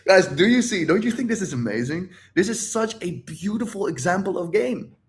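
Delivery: high, delighted, laughing through it; gleeful excitement. Real, not acted. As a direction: gush to the audience, tickled. Delighted that his prediction came true.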